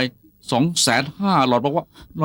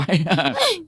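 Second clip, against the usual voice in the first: awkward laugh. Acted. Awkward laugh after blunder.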